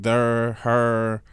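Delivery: "there" and "her" as thur and hur; Saint Louis accent. Shown as a demonstration. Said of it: "Midwestern accent is like that Saint Louis".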